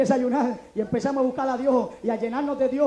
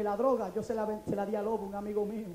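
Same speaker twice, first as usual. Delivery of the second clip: ordinary talk, nothing marked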